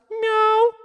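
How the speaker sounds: high, loud cat meow; imitation cat, way up high. Shown as a demonstration. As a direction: give a high, insistent meow. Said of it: "have you meowed and mooed".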